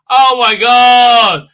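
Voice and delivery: loud, slow, drawn-out oh my God; delighted groan. Real, not acted. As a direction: savour it like you can taste the biscuit. Reacts to buttery biscuit.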